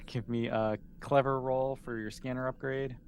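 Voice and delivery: normal talking voice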